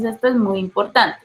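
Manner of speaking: usual speaking voice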